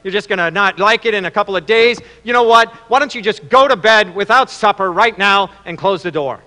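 rapid scolding dad lecture; acted exasperated father telling off his son; chewing out. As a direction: lecture the boy, building to a firm order. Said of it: "he starts chewing out the son, giving him a lecture".

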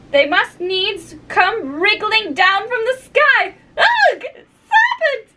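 high rant building to a piercing screech; rising to shriek. A narrator's reading. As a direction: work up from complaint to shriek. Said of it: "raising its voice to a shriek".

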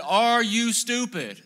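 loud, slow, high shout with a laugh; yelled insult. Retold or imitated. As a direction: bellow it up at the bus window. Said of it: "and I said".

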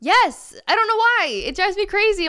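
high, loud exclaiming; exasperated. Real, not acted. Answering a friend about having an accent, loud high exasperation. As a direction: burst out in exasperation.

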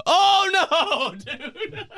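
high, loud wail breaking into laughter; shrieked dismay, then giggling; horrified laughing scream. Real, not acted. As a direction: cry out in amused agony. Screaming at Quadoba.

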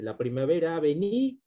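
"venido" with a long rising NI, pause, then DO; stress on last accented vowel. Shown as a demonstration. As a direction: hold the ni in "venido" before a separate do. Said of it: "a partir de la última vocal acentuada".

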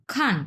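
খান high, then falling sharply; crisp falling-tone খান; fourth tone. Shown as a demonstration. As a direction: drop the pitch steeply on খান. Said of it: "চার number tone".

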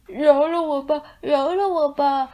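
a cowering ox pleading for his life; begging for mercy. A narrator's reading. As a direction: beg desperately to be spared. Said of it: "趴在地上求饶".

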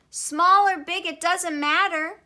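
high, loud reassurance; child's voice. A narrator's reading. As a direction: cheer him up like an emphatic child. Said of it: "the child said".

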